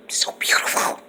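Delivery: soft whispered "So beautiful"; whispered aside. Real, not acted. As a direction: breathe it out as a whisper. Soft aside after the squeal.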